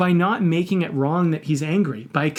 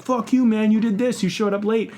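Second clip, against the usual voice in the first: higher-pitched retold outburst at usual volume; mock angry accusation; 6 semitones higher than usual. Guy accuses his late friend, mock anger. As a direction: play an annoyed guy having a go.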